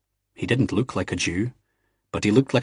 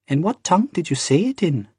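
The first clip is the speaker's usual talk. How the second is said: put-on curious young barmaid, much higher voice; 9 semitones higher than usual. A narrator's reading. Barmaid quizzing Jamie, high and curious.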